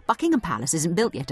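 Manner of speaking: usual speaking voice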